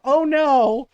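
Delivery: loud, high, drawn-out cry of dread; alarmed outcry. Retold or imitated. Daughter seeing mom reach for her purse, alarmed outcry. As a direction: wail it out in alarm.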